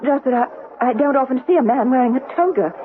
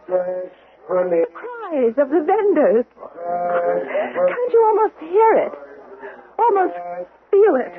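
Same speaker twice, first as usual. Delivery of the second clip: dreamy slow evoking; much slower than usual. Acted. Imagining market, slow dreamy.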